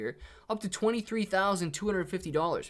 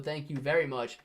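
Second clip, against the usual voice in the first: ordinary delivery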